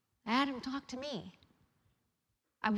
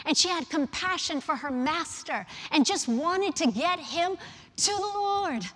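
loud, higher, fervent preaching; preaching at full force; 5 semitones higher, 9 dB louder than usual. Acted. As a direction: drive the point home from the pulpit.

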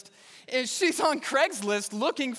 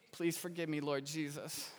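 much softer, lower mock confession; sheepish prayer to Jesus, much softer; guilty aside; 5 semitones lower, 12 dB quieter than usual.

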